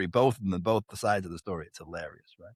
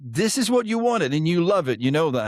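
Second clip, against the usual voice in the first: higher, louder, firm scolding; wife scolding; 7 semitones higher, 3 dB louder than usual. Retold or imitated. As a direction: tell him off, no nonsense. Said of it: "But she would say to me".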